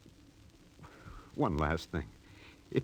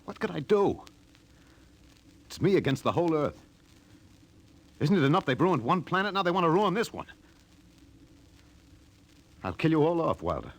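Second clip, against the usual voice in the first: impassioned; 8 semitones higher than usual. Acted. Spender defending his killing to the captain, heated. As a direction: let it burst out of him.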